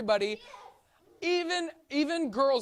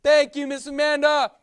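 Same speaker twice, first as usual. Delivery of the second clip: ordinary talk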